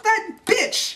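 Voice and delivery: high-pitched comic indignation; mock outrage. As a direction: squeal it in pretend fury. Everybody freaks out at escape.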